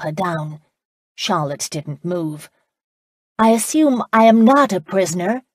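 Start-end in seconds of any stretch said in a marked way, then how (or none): 3.4-5.4 loud, higher and defiant; loudly. A narrator's reading. Charlotte challenges the laird from horseback, loud and high. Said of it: "she said loudly".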